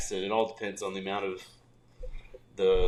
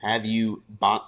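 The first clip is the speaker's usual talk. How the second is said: ordinary delivery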